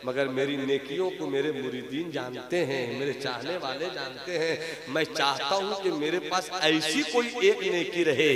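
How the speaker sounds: soft, calm fatherly answer; gentle saint. Acted. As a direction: answer softly, with quiet composure. (नरम, शांत आवाज़ में)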